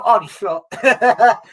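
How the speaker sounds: laughing through the words at his own wish; real laugh while admitting a daft hope. Real, not acted.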